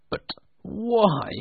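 a high, crying nervous plea. Acted. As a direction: plead anxiously, near tears. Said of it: "asked Scrooge nervously".

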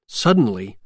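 ordinary delivery

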